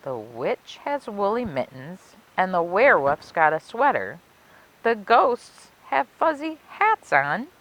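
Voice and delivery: put-on lilting rhymed read-aloud for children; storybook narration. A narrator's reading. Librarian reading a Halloween rhyme to kids, storybook lilt. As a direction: savor each rhyme like a spooky bedtime tale.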